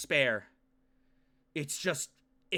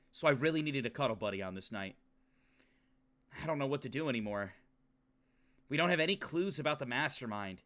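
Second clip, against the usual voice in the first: lower, measured reading voice; story narration; 4 semitones lower, 3 dB quieter than usual. Acted. Streamer reading the character's bedtime thoughts, subdued narration. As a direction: read it like an inner monologue, subdued. Reads game narration aloud.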